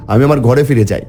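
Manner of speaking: ordinary delivery, nothing marked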